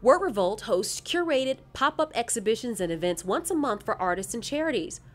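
measured, formal recorded read; bio read over music. Acted. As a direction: read the nominee bios like a video narration.

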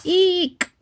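startled squeak; tiny high shriek; high squeal eek. Acted. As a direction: squeal as if tickled. Reaction to itchy touch.

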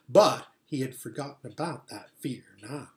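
usual speaking voice

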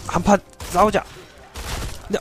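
ordinary delivery

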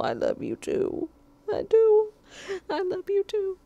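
high squeaky voice for the cat; voicing the cat back to herself; pet voice reply. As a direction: answer as the cat, cutesy.

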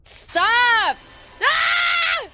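loud, high panicked yell; frightened scream. Real, not acted. Streamer startled by horror game, frightened screaming. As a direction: scream and shout in real fright.

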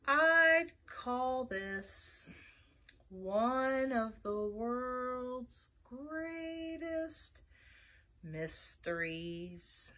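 extremely slow, trailing down softly; slow sloth. A narrator's reading. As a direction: drag every word out with long pauses. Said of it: "said the sloth slowly".

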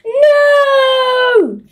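high, drawn-out wailing shout; anguished wail. Acted. Henry horrified his Super Soaker is taken, anguished wail. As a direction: cry it out in dismay, long and high.

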